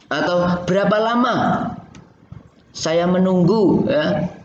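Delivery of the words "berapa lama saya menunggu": long pause after "lama", then "saya menunggu?"; wrong phrasing. Shown as a demonstration. Teacher demonstrating a badly placed break after "lama". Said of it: "Itu kan nggak cocok".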